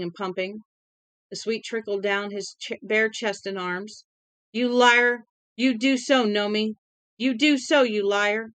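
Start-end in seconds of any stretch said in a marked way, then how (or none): 4.5-8.5 raw, desperate yelling; angry shouting. Acted. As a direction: hurl it at him, half sobbing. Captive accuses his captor.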